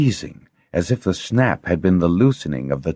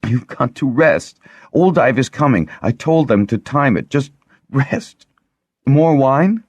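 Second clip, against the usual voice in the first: higher, anxious coaxing; tearful coaxing; 8 semitones higher, 4 dB louder than usual. A narrator's reading. Lessa coaxing the Harper to rest, higher and tearful. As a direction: soothe him while fighting tears. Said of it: "gulping back her sobs".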